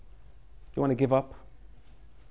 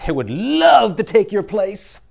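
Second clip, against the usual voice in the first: louder, slow and stretched out with a laugh; a laughing, emphatic plea; enthusiastic; 4 semitones higher, 7 dB louder, slower than usual. Real, not acted. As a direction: pour everything into love. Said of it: "I can't even say it with enough enthusiasm".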